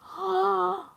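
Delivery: high, drawn-out gasp; excited gasp. Real, not acted. Streamer hoping husband shares his food, excited gasp. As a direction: gasp with delighted hope.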